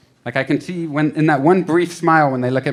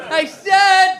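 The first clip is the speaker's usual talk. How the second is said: put-on high-pitched kid's shout; mock scream; 3 dB louder than usual. Son screaming at theme-park Mickey, mock scream. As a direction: shriek it like a fed-up small child. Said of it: "be screaming at Mickey Mouse".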